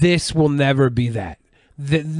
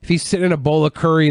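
normal talking voice

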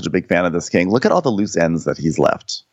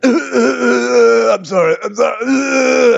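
high, drawn-out wailing imitation; hammed-up blubbering apology; mock crying; 3 dB louder, slower than usual. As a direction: wail it out in a comic fake sob. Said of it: "then they start crying".

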